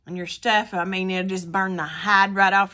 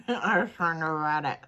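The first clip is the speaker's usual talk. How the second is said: muffled behind fingers; speaking through covered lips; hand over mouth; 5 semitones lower, 5 dB quieter than usual. Real, not acted. Seen 2: Woman's fingers cover her mouth through all labelled frames.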